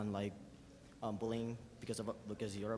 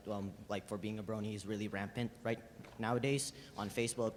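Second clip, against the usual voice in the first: usual speaking voice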